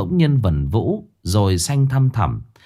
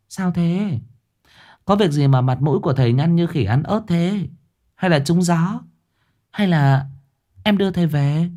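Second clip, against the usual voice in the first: higher woman's voice, fussing over the master; a sharp, higher-pitched woman voiced by the narrator; Hạnh's voice; 8 semitones higher than usual. Hạnh questions the master. (giọng người phụ nữ cao, lo lắng hỏi thầy)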